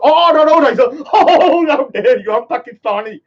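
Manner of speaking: a laughing parody of a scandalised judge; mock outraged judge. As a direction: ham up an offended judge. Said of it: "And the court goes".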